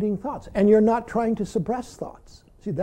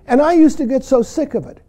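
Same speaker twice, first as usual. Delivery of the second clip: usual speaking voice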